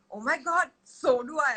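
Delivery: high-pitched, exaggerated agreement; playful mock excitement. Aunt agreeing with little niece, playfully excited.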